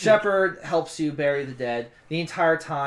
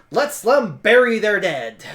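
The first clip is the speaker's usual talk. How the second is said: raised-voice appeal to the group; firm urging; 5 semitones higher, 9 dB louder than usual. Acted. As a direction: urge them on, louder and higher. Character orders the others on.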